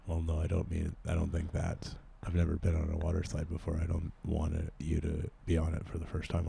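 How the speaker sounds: low, soft, subdued character voice; odd sheltered loner. Acted. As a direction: play a quiet oddball stranger, kept low. Improv character on water slide.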